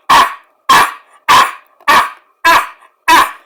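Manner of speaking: hype chant. Retold or imitated. Building hype for Booker T's moment, loud chanting. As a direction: pump up the crowd.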